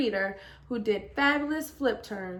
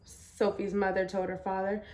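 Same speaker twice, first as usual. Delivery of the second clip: ordinary delivery, nothing marked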